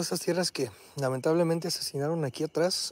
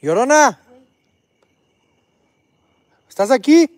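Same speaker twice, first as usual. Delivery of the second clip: higher, loud call into the dark; 7 semitones higher, 14 dB louder than usual. Real, not acted. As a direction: shout it out to the spirit. Calling out to La Llorona.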